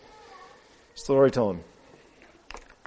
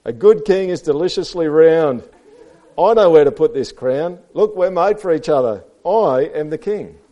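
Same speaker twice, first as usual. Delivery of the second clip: lightly voiced storybook pig; animated read-aloud character; self-satisfied pig; 8 semitones higher, 12 dB louder than usual. A narrator's reading. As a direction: boast it high and loud. Reads Pig's speech to children.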